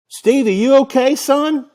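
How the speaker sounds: high, loud North Carolina drawl over casino noise. Retold or imitated. Old dealer calling from Vegas slots, loud Carolina drawl. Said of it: "With the North Carolina drawl".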